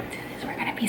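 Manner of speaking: hushed unvoiced whisper; whisper yelling. Real, not acted. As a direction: keep it to a breathy hush. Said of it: "I'm over here whispering".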